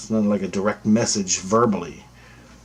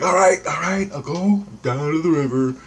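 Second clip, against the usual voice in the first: exasperated giving in; 8 semitones higher than usual. Retold or imitated. Giving in to inner voice.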